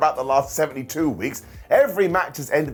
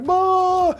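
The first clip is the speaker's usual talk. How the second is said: much higher, louder mock yelp; mock Chelsea shriek; 5 dB louder than usual. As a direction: squeal it like a shrill heel distraction. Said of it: "it was all like".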